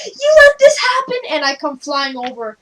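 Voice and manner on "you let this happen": accusing shout. Acted. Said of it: "I'm like".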